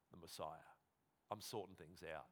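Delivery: low, hushed understatement; quiet revelation. Acted. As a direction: drop right down and state it softly. Voicing Jesus revealing himself.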